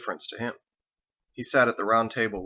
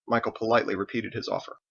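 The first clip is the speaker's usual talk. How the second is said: usual speaking voice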